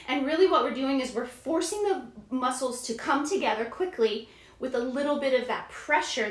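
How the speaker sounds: normal talking voice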